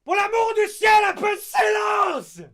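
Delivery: yelled very high and loud in French; sudden French shout. Retold or imitated. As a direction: explode at the class. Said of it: "He just suddenly shouted".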